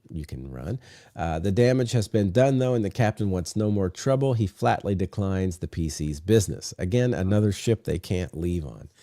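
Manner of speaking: ordinary talk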